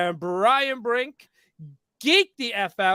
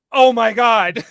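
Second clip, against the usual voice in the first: a louder, laughing oh my God; laughing realization; 9 dB louder, slower than usual. Retold or imitated. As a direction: burst out laughing as it clicks. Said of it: "it clicks where I'm like".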